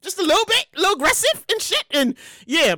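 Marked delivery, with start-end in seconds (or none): none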